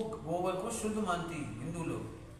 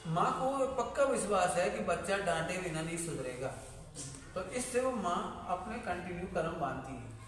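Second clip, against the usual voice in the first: ordinary talk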